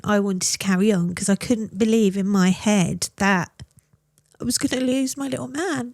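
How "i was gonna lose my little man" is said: tearful. Real, not acted. Fear of losing her son.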